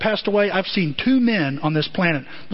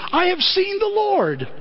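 excited news; 13 semitones higher, slower than usual. Acted. Mary tells disciples excitedly.

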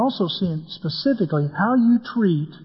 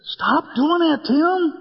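mock scolding; 9 semitones higher, slower than usual. Family scolding him.

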